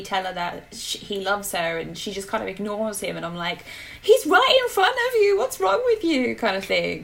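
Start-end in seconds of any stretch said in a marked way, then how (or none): 4.0-6.4 much higher, louder mock exasperation; shouting at the character, higher and louder; exasperated outburst. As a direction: yell at the screen in disbelief.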